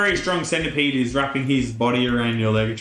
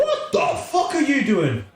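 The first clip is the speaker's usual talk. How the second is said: shrieking centipede, much higher; 13 semitones higher than usual. Acted. Centipede yelling at the guy stuck in the wall, high and shrill. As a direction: squeal it out as the startled centipede. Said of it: "the centipede guy's like".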